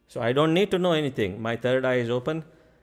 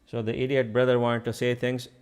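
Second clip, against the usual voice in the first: usual speaking voice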